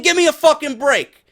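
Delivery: ordinary talk, nothing marked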